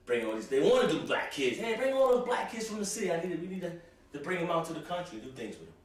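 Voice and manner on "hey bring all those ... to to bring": lightly voiced eager recruiter, higher; chirpy put-on organizer calling out; a hint of a cheerful do-gooder; mock organizer voice. Mocking the program's organizers.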